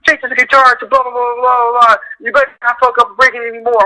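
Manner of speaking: high, bossy orders, sped up at the end; mock bossy boss ordering the runner. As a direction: rattle off orders like a boss to his grunt. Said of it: "He's like".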